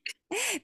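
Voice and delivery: high breathy laugh; a quick embarrassed giggle; sudden high laugh. Real, not acted. Laughing at own pronunciation.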